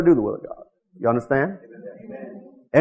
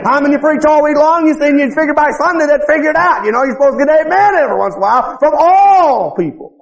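raised, insistent preacher's rebuke; fired-up preaching; 10 semitones higher, 4 dB louder than usual. Real, not acted. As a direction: scold the flock from the pulpit, pitched up. Preaches at full force.

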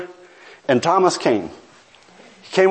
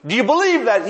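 higher, urgent pleading with a friend; preacher imitating himself begging a coworker to pray; begging plea; 9 semitones higher than usual. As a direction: plead with him to pray now. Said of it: "I begged, I pleaded".

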